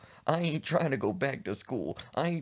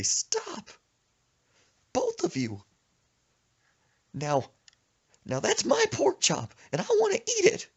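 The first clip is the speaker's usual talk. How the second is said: high, louder mock yell to break up a fight; comic high yell; 4 dB louder than usual. Narrator stopping the pork chop fight, high mock yell. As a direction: squeal it like a panicked referee.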